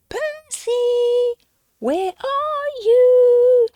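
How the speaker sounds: high, long call across the snow. Acted. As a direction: call for a lost friend, drawn out. Penguins searching, calling for Percy.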